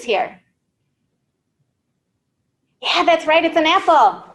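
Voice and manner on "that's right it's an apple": normal talking voice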